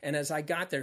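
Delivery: usual speaking voice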